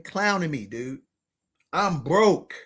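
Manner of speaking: normal talking voice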